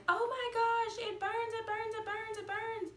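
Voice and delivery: high, panicked cry of pain; freaking out. Retold or imitated. Mother in labor panicking at the burning pain. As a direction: squeal it like the burn just hit. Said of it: "I started to freak out".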